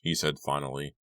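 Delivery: plain reading voice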